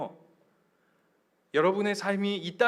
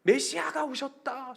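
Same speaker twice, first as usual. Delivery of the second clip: high-pitched cheering of a welcoming crowd; crowd crying out; 6 semitones higher than usual. Retold or imitated. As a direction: imitate a jubilant crowd hailing the Messiah. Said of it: "수없이 외쳐요". (환호하며 외치는 군중 흉내)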